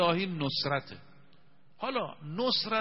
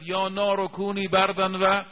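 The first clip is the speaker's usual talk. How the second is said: Quranic recitation; 7 dB louder, slower than usual. Shown as a demonstration.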